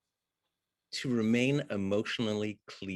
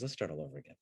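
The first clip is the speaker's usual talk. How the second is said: lower, soft mock weariness; imitating the actress, quiet; 6 semitones lower, 10 dB quieter, much faster than usual. Actress asks her partner to restart, low and soft. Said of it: "And she goes".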